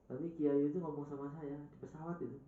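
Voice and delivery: ordinary talk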